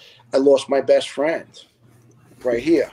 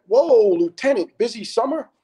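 mocking doctor; 10 semitones higher than usual. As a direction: imitate a doctor needling a patient, pitched up. Doctor's greeting mockingly retold.

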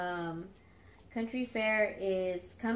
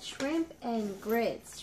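slow reading; much slower than usual. Real, not acted. Reading treat name slowly.

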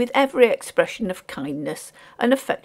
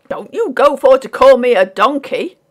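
loud, higher working man's retort; affronted porter; 6 semitones higher, 10 dB louder than usual. A narrator's reading. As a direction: push back hard, offended.

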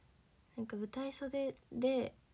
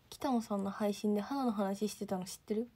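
ordinary talk, nothing marked

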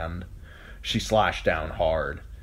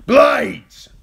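loud high battle shout; 15 dB louder than usual. A narrator's reading. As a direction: bellow the alarm. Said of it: "Yoren shouted".

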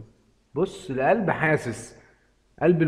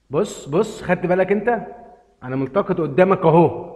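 higher, much louder triumphant shout; excited shout; 5 semitones higher, 7 dB louder than usual. Real, not acted. Gamer showing his winning catch to the viewer, loud excited shout. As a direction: yell the win at the viewer.

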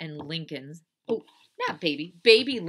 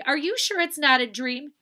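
high, loud doubtful question; Baby Lincoln; 9 semitones higher, 8 dB louder than usual. Acted. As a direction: question an older sister in a raised voice. Little sister doubting.

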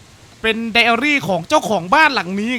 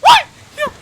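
much higher, louder, sudden jump; real fright shout; startled yell; 11 dB louder than usual. Real, not acted. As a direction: yelp at a sudden scare. Sudden fright in horror game.

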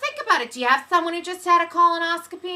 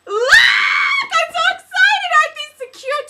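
excited squeal; 11 semitones higher, 7 dB louder than usual. Real, not acted. Host squeals at the toe socks, high and loud.

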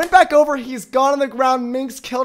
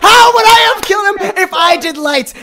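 loud, indignant protest in a raised voice; heated protest; 4 semitones higher, 6 dB louder, faster than usual. Real, not acted. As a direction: argue back hard, defending yourself. Heated argument over the game.

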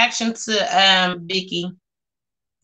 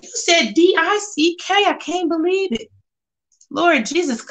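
mock shock; 8 semitones higher than usual. Mock outrage at Tasha's comment.

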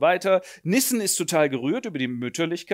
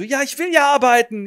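high, louder plea; a lightly voiced whining tenant; whining excuse; 11 semitones higher, 8 dB louder than usual. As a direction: whine your excuses.

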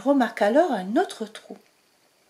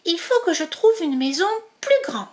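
little mouse thinking; 6 semitones higher than usual. Acted. Zazie speaking.

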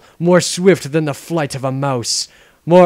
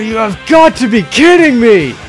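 outraged; 6 semitones higher, 8 dB louder than usual. Acted. Outraged at montage.

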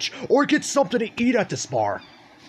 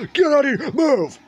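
shouting; 8 semitones higher, 5 dB louder than usual. Real, not acted. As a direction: yell it high and loud. Shooing things away in game.

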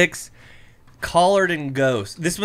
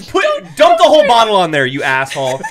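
fast loud mock scold; 4 semitones higher, 9 dB louder, faster than usual. Real, not acted.

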